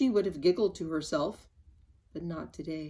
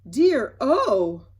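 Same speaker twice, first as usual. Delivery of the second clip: loud startled cry; exclaimed; 3 semitones higher, 11 dB louder than usual. Acted. Mister Topsy-Turvy hearing the news, loud exclaim. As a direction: burst out in alarm. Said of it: "Mister Turvy exclaimed".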